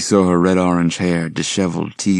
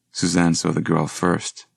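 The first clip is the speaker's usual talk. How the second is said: plain reading voice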